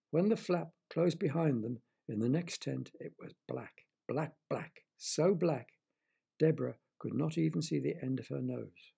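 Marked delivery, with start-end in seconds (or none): none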